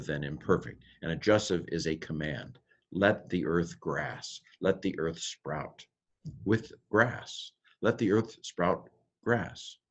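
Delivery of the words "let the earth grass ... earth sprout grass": slow, careful read of the verse; slow deliberate translation. Shown as a demonstration. Teacher slowly reading the verse aloud to the class, deliberate. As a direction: lay out each word of the verse slowly.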